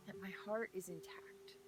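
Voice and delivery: soft, hushed and intimate; whispering a tender truth to viewers. Real, not acted. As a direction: confide it in a whisper.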